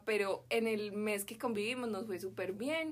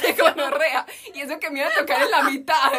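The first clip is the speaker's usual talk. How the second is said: louder and higher amused outburst; loud laughing exclaim; 5 semitones higher, 14 dB louder than usual. Real, not acted. Complaining to friends about her seat, loud and amused. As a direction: blurt it out loud, still laughing.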